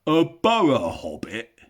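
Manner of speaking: loud, slow puzzled troll voice; startled trolls. Acted. Trolls puzzle over the word, startled. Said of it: "said they, a bit startled".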